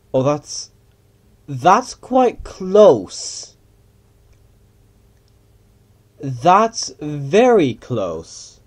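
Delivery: loud, slow, stretched-out alarm; drawn-out alarm. Real, not acted. Pilot seeing something quite close, loud drawn-out alarm. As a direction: stretch each word loudly as danger nears.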